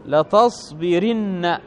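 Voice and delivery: latasbirinna, slow with an i before the doubled n; kasra before nun. Shown as a demonstration. Teacher showing students the feminine form, لتصبرن slowly with kasra. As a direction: stretch out لتصبرن as la-tas-bi-RIN-na. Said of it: "يكسر ما قبل النون".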